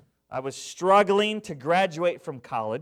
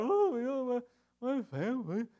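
whiny mocking voice, softer; mock whining; 6 semitones higher, 5 dB quieter than usual. Preacher mocking his whiny younger self. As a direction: imitate his own moaning past self. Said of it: "I was whining and I was moaning".

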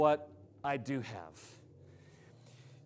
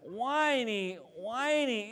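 high, nasally whine, drawn out; demonstrated nasal whining; whiny; 5 semitones higher, slower than usual. Shown as a demonstration. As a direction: say it in the whine it describes. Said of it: "it's, it's nasally".

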